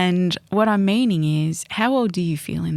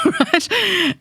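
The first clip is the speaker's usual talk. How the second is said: ordinary delivery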